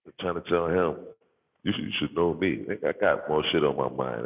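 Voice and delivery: normal talking voice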